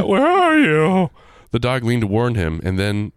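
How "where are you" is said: high, loud, slow frightened wail; storyteller echoing the hammed-up terrified cry. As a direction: stretch it into a panicked wail.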